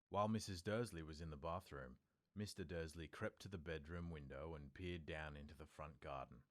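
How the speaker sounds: ordinary delivery, nothing marked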